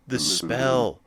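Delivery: a gasp, then the words said aloud with a falling tune; surprised recognition after a gasp; gasped realization. Real, not acted. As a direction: catch your breath, then name it. Realizing what was dropped.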